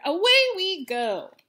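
loud, high and slow; excited cowboy cry. Acted. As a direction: call it out as you ride away. Riding off on the pig.

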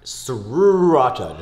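Sriracha with a long rolled-together "srrru" start; overdone "sroo-racha" for Sriracha; exaggerated SR pronunciation. Shown as a demonstration. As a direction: draw out Sriracha slowly, no vowel between S and R. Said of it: "sriracha is S R".

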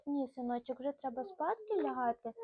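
usual speaking voice

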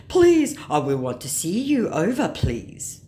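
mock a pleading online scammer, hitting the opening hard. Scammer begging a fan to come over, mock pleading. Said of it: "he says".

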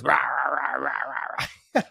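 imitating a rough raspy growl. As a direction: rasp out the rahs like him. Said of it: "just the raspy voice".

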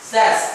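says said as "sez", short e; teacher models says as "sez"; correct says. Shown as a demonstration. Teacher shows class how to read says. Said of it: "Chữ này đọc là says".

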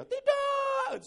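long, piercingly high, loud cry; imitated shout. Preacher imitating a stranger screaming behind the audience, shouting. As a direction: scream it in protest. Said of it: "tiba-tiba ada orang teriak".

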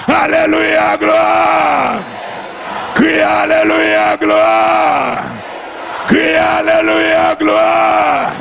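high, sustained chanted shout; praise cry. Real, not acted. Preacher leading the cry of the saved, chanted shout. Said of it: "c'est le cri des sauvés".

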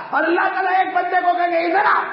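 very high, fast preacher's shout; full-voiced high commanding cry; thundering summons. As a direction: shout the call across a huge crowd. God summons a man before all.

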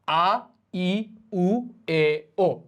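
each vowel, আ ই উ এ ও, said separately; vowel demo. Shown as a demonstration. Teacher introducing the first five vowels, said separately and clearly. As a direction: sound out আ, ই, উ, এ, ও one by one. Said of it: "প্রথম পাঁচ ভাওয়েলটা আমরা শিখি আগে".

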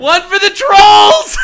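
high, loud, slow quoted shout; mock shout. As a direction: yell the quote in delight.

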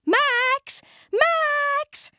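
high, loud call across the garden. Acted. Fizz calls Max over to see the frog, loud and high. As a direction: shout his name, high and bright. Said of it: "she called".

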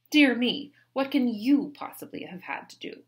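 loud, high disdainful lady; Mary scoffing. A narrator's reading. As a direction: brush her excuses aside.

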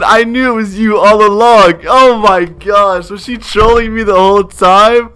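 high-pitched, incredulous exclamation; amazed realization. Real, not acted. Realizing he was trolled.

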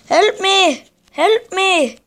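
high-pitched, loud cry for help; calling for help. Real, not acted. Player begging for rescue in a match, high urgent yell.

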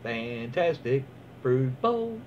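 drawn out, relishing each word of Fantastic fruit bowl; slow savoring read. Shown as a demonstration. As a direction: let Fantastic fruit bowl roll out slowly. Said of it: "this just has to be read".

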